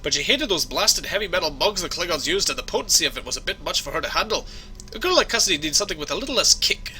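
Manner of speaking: conversational Scottish brogue; Scottish accent. Acted. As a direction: offer help as a Scottish engineer. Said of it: "my Scottish accent needs some work".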